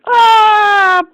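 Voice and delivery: a cartoon alien shrieking as it's shot; death scream. Alien shot from behind letting out a high death shriek.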